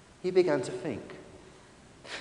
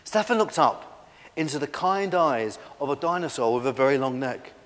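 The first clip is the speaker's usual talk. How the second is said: usual speaking voice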